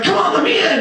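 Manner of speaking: high, strained yell; acted impatient pleading. Soul banging away at heaven's gates, impatient pleading. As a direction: beg to be let through.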